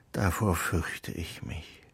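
a small fearful confession; timid admission. Acted. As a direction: confess it quietly and slowly. Geppetto admits fear of child.

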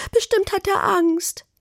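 high, pitiful whine; wailing. A narrator's reading. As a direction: fret aloud in a whimpering high voice. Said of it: "jammerte Carla".

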